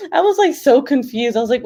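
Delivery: ordinary delivery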